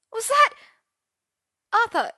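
high, loud burst of shock; startled squawk. A narrator's reading. Merlin finding Arthur on the floor, high startled squawk. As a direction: break off, high and alarmed.

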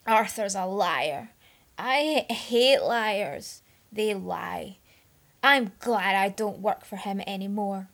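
put-on loud drunken vehemence; loud, slurring drunk rant; vehement. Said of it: "Merlin concluded vehemently".

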